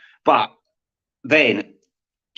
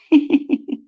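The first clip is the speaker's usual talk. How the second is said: high squeaky laugh. Real, not acted. Laughing at his own joke, high squeaky giggle.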